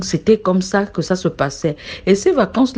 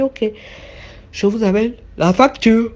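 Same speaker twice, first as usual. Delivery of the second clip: waiter's voice; 4 semitones higher than usual. Retold or imitated. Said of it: "il a dit".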